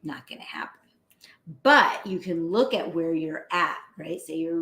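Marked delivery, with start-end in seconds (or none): 0.0-0.8 low, soft throwaway whisper; whispered aside. Real, not acted. Telling colleagues rage won't become joy, whisper. Dry aside on impossible change.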